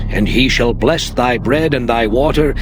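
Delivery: usual speaking voice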